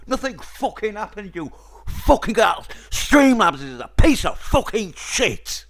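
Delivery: loud, high furious yelling; angry shouting. Real, not acted. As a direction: scream it at the screen. Raging at the stream not working.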